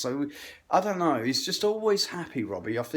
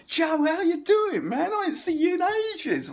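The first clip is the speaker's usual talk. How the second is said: fake enthusiastic greeting. Greeting Joe falsely enamoured.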